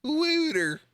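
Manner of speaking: "Wooter" pitched very high on a long oo; incredulous high-pitched "Wooter"; mocked pronunciation. Shown as a demonstration. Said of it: "this is the word".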